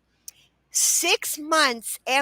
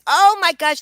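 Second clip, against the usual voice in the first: louder, higher storybook gasp of amazement; mock astonishment; 4 semitones higher, 9 dB louder than usual. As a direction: exclaim it like a children's story. Acting out her children's book.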